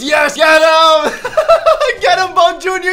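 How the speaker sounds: high, loud cheering with laughter; laughing cheer. Real, not acted. Gamer cheering his baby mob on, laughing. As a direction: egg him on, cracking up.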